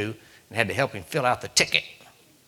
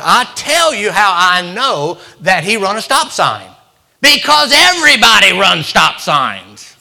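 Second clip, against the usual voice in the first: much louder, higher, slowed preacher's cadence; preaching; 5 semitones higher, 10 dB louder, slower than usual. Retold or imitated. Lecturing the game wardens like a fired-up preacher. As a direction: preach it at them, building slowly. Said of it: "the anointing was coming on me".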